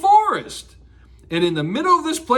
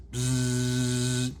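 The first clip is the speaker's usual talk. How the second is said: low, soft bee buzzing; 10 semitones lower, 7 dB quieter than usual. Shown as a demonstration. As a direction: buzz like a bee. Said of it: "can anyone out there make a bee sound".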